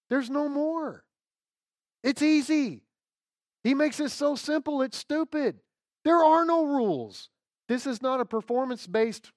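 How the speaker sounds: high, loud gospel proclamation; full-force preaching. Acted.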